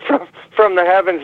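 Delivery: usual speaking voice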